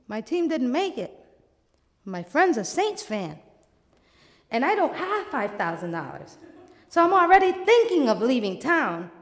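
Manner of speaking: ordinary delivery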